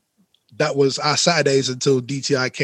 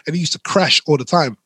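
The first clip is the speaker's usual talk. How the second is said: ordinary delivery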